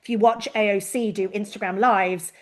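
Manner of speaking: ordinary talk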